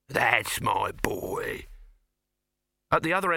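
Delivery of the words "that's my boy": satisfied Dibbler. A narrator's reading. Dibbler praises nephew.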